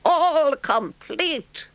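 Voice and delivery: very high, loud, slow boast; triumphant. A narrator's reading. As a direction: proudly show off the locker. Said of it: "said the Toad triumphantly".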